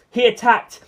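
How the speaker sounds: ordinary delivery, nothing marked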